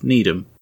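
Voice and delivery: NEEDHAM with a weak ending, need-um; local pronunciation. Shown as a demonstration. Host names the town he visited, Needham as need-um. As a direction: swallow the ham in Needham, need-um. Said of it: "No, Needham".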